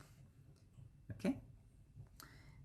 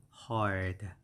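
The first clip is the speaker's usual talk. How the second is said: ordinary talk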